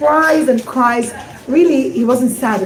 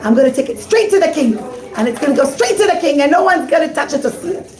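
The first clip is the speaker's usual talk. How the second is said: plain reading voice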